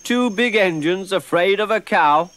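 high, loud gleeful tease; amused teasing. A narrator's reading. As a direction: poke fun at the big engines. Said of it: "chuckled Edward".

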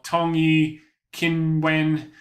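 "Tongyi" and "Qinwen" sounded out in halting Chinese; foreigner speaking mandarin. Real, not acted. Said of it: "my Chinese is not, not very good".

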